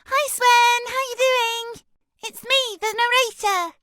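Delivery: comically squeaky, very high narrator; tiny high-pitched cartoon hello; suddenly high voice. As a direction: chirp it far above your natural voice. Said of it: "suddenly I'm up like this".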